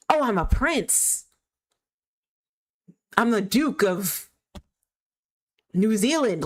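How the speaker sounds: mocking a fake prince. Mocking the scammer's claims.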